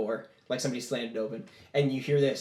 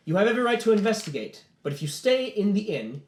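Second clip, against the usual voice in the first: raised, louder voice of authority; stern warning; 7 semitones higher, 4 dB louder than usual. Acted. Officer warning about staying in the inn, stern. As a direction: lay down the rule forcefully.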